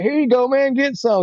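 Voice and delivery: high-pitched, generous retold voice; eager offer. Homeless man sharing his fries, eagerly imitated. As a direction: hand it over in a lifted, upbeat pitch.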